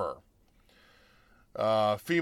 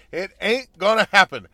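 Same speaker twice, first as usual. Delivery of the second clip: ordinary delivery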